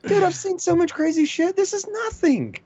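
indignant high-pitched disbelief; incredulous outburst. Real, not acted. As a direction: protest with rising pitch. Insisting his life isn't crazier.

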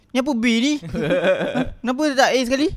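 high mocking dad voice; imitating father. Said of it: "bapak aku macam". (tiru suara bapak mengejek, nada tinggi)

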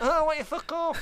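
high, loud hammed-up Scouse; Liverpool accent. Punks telling teenagers off, in loud mock Scouse. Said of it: "that's me being Liverpool again".

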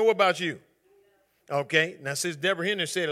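ordinary talk

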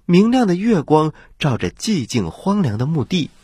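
ordinary delivery, nothing marked

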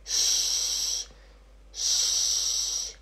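"sh" drawn out with no vowel; long sh. Shown as a demonstration. Teacher repeating the SCH sound, long "sh". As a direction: hold a long voiceless "sh". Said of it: "faz som de".

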